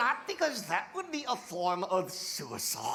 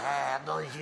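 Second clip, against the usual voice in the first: mock French accent; 9 semitones lower than usual. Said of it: "give it a French accent".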